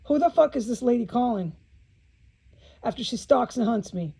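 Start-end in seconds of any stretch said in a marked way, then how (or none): none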